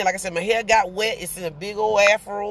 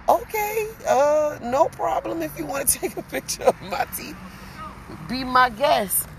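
higher, giggly flustered yes; giggling bashful agreement, a bit higher; flustered delight; 7 semitones higher than usual. Retold or imitated. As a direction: laugh through the answer, thrilled and shy. Flustered, thrilled reply to the dentist.

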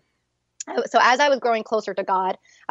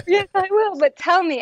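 high, eager and a little louder; eager reply; 10 semitones higher, 3 dB louder than usual. Retold or imitated. To her husband calling from work, high excited urging. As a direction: push him to spill the news, excited.

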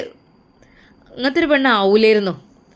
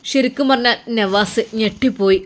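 usual speaking voice

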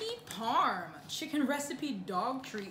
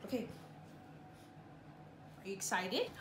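ordinary delivery

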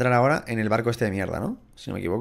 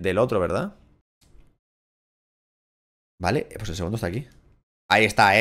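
normal talking voice

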